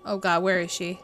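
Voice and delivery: ordinary delivery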